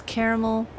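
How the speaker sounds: caramel said "KAIR-uh-mul"; three-syllable variant. Shown as a demonstration. Streamer listing the versions, three syllables. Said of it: "Caramel is how I say it".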